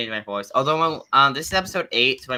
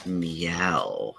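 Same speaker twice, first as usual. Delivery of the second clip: lower, drawn-out meowing; low cat meow; 7 semitones lower, 7 dB quieter, much slower than usual. Pretend. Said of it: "What is wrong with that meow?".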